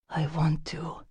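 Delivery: soft, breathy near-whisper. A narrator's reading. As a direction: whisper it into her hair. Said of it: "he whispered against my hair".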